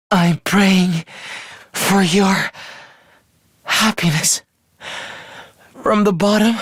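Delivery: halting, a few words per breath; dying last wish. Acted. Praying for friends' happiness while dying, halting. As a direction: struggle to get each word out.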